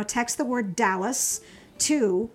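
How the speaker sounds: normal talking voice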